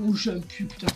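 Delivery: ordinary talk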